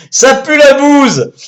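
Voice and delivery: mock disgust. Deflating the village name with mock disgust. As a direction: sneer it out. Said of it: "punchline after laugh".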